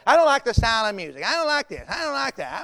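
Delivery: mock whine, petulant and repetitive; put-on griping voice; whiny complaining. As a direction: imitate a fussy complainer listing gripes. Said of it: "people complain about everything".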